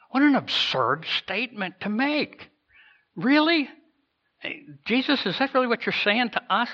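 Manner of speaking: high-pitched disbelief, rising questions; incredulous. Acted. Ragtag disciple questioning Jesus' absurd statement, incredulous. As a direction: question it as if it can't be true.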